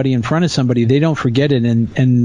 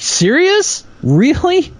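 much higher, disbelieving squeak; incredulous; 13 semitones higher, slower than usual. Retold or imitated. Reacting to the earthquake ad, incredulous and high. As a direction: shoot up in shocked disbelief. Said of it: "I'm like".

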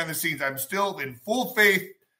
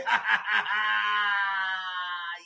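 laughing shout; 3 semitones higher, 6 dB quieter than usual. Real, not acted. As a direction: break into a howling laugh. Laughing after announcer bit.

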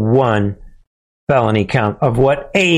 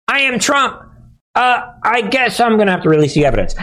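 higher, whiny caving voice; mock whiny Trump; 8 semitones higher, much faster than usual. Host imagining Trump giving in, high whiny mock plea. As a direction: whimper out a confession. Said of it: "before we put you in jail".

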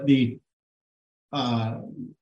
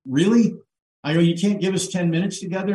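higher, louder incredulous protest; retold stunned disbelief; 5 semitones higher, 3 dB louder than usual. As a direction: push back in disbelief, as retold. Retold protest at being rushed.

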